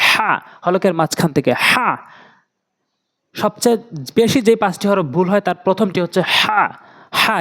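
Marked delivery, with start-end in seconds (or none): 0.1-1.9 হা pushed from mid-throat; Arabic হা with throat friction; throat letter হা. Shown as a demonstration. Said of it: "হা উচ্চারণ করে বলতে হবে". 7.2-7.4 হা with pressure and weight; heavy Arabic হা; pressed হা. Shown as a demonstration. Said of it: "চাপ দিয়ে ভর দিয়ে উচ্চারণ করব".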